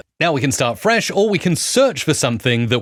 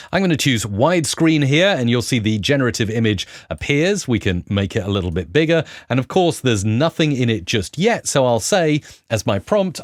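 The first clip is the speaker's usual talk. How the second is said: usual speaking voice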